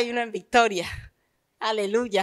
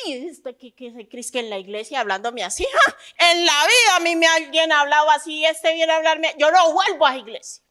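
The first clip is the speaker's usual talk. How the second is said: mock offended churchgoer; 4 semitones higher than usual.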